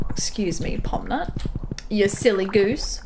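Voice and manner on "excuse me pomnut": whispered aside. Real, not acted. Unvoiced excuse me.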